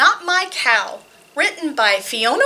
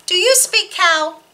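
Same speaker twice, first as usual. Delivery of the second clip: usual speaking voice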